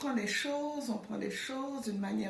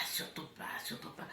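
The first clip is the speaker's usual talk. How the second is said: fading whisper, softer and softer; whispered self-warning; 9 dB quieter, faster than usual. Retold or imitated. Newly arrived, warning herself not to catch the accent, whispered. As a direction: hush it to yourself, dying away.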